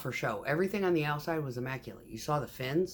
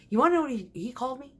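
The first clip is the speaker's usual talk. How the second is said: indignant; 10 semitones higher, 4 dB louder, much faster than usual. Retold or imitated. Retelling insult at office.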